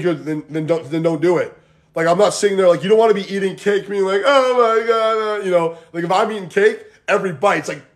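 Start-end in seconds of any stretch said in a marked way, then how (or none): 4.2-5.4 long, high, drawn-out "oh my God"; mock agonizing. Imitating a guilt-ridden cake eater, mock agonizing. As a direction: stretch it out slowly in mock anguish.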